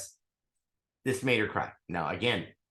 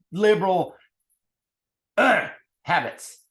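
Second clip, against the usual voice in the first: loud, high, angry rant; 8 semitones higher, 7 dB louder than usual. Real, not acted. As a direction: blast it out, fed up and furious. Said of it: "'Cause now I'm angry".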